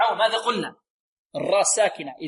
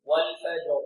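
slow, measured tajweed chant; Quran recitation; 2 semitones lower, much slower than usual. Shown as a demonstration. Teacher leads students in repeat-after-me recitation, slow tajweed chant. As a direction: intone it on a steady note with long vowels.

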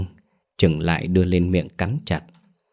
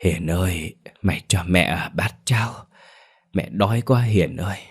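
feeble rasp. A narrator's reading. Old woman begging her daughter-in-law for porridge, faint feeble plea. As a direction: plead weakly, barely there. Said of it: "lại thều thào cất lên".

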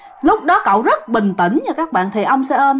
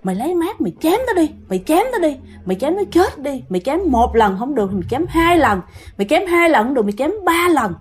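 higher, lightly voiced spirit pressing him on; urging ghost voice; 5 semitones higher, faster than usual. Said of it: "thôi thúc".

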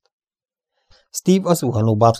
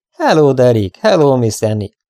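loud, lifted hail from afar; cheerful shout; 4 semitones higher, 6 dB louder than usual. A narrator's reading. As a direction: call out a merry hello. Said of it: "kiáltotta már messziről vidáman Spilling".

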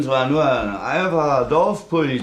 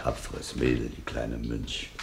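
muttered aside; 13 semitones lower, 11 dB quieter than usual. Acted. Old policeman muttering aside.

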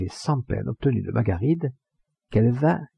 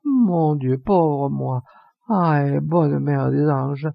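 high drawn-out wail, falling on each cry; slow, plaintive appeal to heaven; half crying, half laughing; 4 semitones higher, 3 dB louder, much slower than usual. A narrator's reading. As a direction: lament half in tears, half amused. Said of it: "moitié pleurant et moitié riant".

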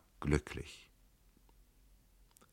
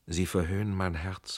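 ordinary delivery

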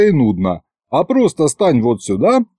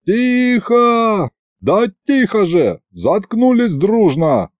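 high-pitched call to hush a crowd; raised, commanding cry without great volume; yelling for silence; 6 semitones higher, slower than usual. A narrator's reading. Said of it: "кивнул я и заорал".

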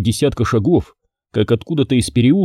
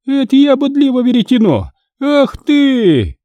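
much higher, louder shrewish tirade; shrill scolding wife; 14 semitones higher, 3 dB louder than usual. Acted. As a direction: rail at the monk like a furious peasant wife. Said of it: "накинулась на Бориску".

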